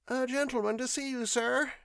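put-on high, fast timid old servant voice; servant Martha. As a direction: announce the visitor meekly, pitched high up. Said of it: "she said".

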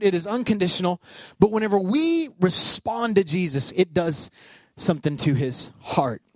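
normal talking voice

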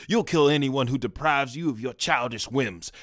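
ordinary delivery

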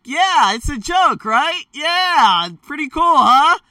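forced cheerful. Retold or imitated. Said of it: "I'm just like".

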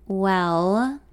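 long, hesitant drawn-out word; dragged out. A narrator's reading. Man stalling before giving news, dragged out. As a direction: drag it out to stall. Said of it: "he drags on".

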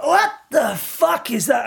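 high, slow, stretched-out alarm; a sudden jab of pain, mock-shocked; retold shocked outburst; startled. Sudden sting in his toe.

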